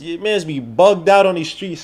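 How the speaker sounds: normal talking voice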